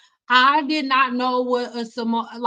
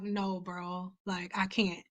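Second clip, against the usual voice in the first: much softer, low, deadpan no; flat refusal; 4 semitones lower, 12 dB quieter, slower than usual. Retold or imitated. Refusing to do the cheer, mumbled softly. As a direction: mutter a reluctant refusal. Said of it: "And I was like".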